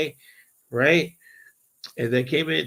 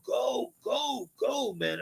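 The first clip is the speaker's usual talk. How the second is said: high-pitched urging without full volume; held-back mock holler, pitched high; urgent retold call; 8 semitones higher, 6 dB quieter, much slower than usual. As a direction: re-enact waving a driver on. Waving driver on, retold.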